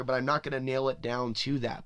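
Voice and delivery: ordinary talk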